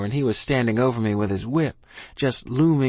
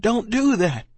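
screamed plea; 10 semitones higher than usual. A narrator's reading. Child begging dying ma, screamed plea. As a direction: plead high, as a restrained scream. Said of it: "I screamed".

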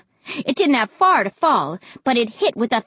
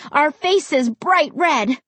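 usual speaking voice

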